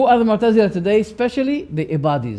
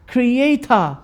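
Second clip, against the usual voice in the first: "creator" in an English accent, final r dropped; drawn-out, high English-accented "creator"; 6 semitones higher than usual. Shown as a demonstration. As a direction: say "creator" slowly as the English do, ending in "ah". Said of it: "You know the English accent".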